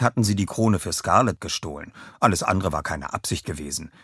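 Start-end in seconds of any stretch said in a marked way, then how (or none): none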